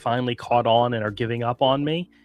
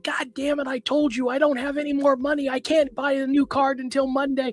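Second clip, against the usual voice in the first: higher, half-shouted retelling of the yell; mock yell; 12 semitones higher than usual. Retelling how he yelled at a phone scammer, mock yell pitched higher. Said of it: "I'm yelling at him going".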